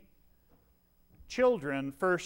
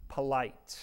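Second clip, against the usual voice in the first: Polite set apart, low and quiet; stress on polite; 4 semitones lower, 4 dB quieter than usual. Shown as a demonstration. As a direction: lean on Polite, alone. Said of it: "You notice the adjective I use there?".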